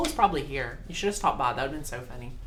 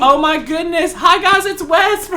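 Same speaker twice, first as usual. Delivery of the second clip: delighted squeal; 10 semitones higher, 9 dB louder than usual. Real, not acted. Reading gift note.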